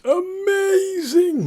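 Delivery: very high, stretched-out rave; long, high, enthusiastic swoon; gushing awe. Real, not acted. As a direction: sing out the word in delight. Gushing about a festival.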